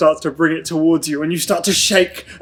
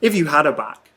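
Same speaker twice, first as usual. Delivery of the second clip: dreamy scene narration. Acted. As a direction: draw them into an eerie dream.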